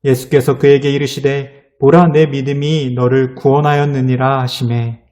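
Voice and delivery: solemn, formal Bible reading; measured, ceremonial recitation of scripture. Acted. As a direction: read the verse out like a pulpit reading. Reads scripture aloud.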